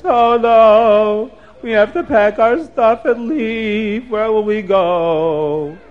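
high, slow wail of a distressed woman; crying woman's voice. Acted. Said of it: "they hear a woman's voice".

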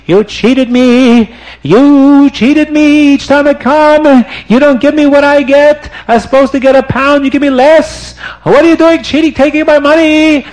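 angry Turk screaming at the rabbi in his store; furious yelling. Acted. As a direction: yell it at the shopkeeper. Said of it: "starts screaming and yelling".

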